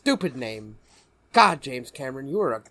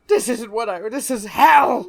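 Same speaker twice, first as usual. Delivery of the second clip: mock horror; 9 semitones higher, 10 dB louder than usual. Friend joins the joke, crying out in mock horror. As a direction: wail that this is hell.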